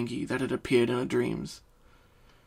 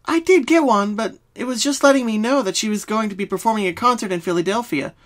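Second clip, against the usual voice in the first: high, bouncy pony friend; Pinky voice; 9 semitones higher, 5 dB louder than usual. Acted. Pinky starts explaining her dreams. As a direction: answer like a cheerful pink pony.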